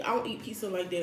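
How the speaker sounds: usual speaking voice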